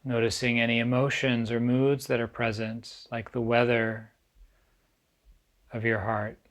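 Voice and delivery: calm, unhurried meditation guide with long pauses; guided meditation. Acted. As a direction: ease listeners gently into stillness, leaving space between phrases.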